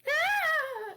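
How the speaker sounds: high loud startled yell; startled cry. Acted. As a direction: yelp at the slamming door. Dramatic yell.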